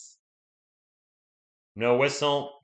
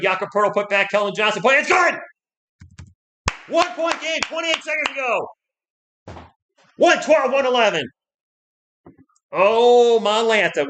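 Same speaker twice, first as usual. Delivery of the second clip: excited shouting; 9 semitones higher, 5 dB louder than usual. Real, not acted. Clutch basket late in game.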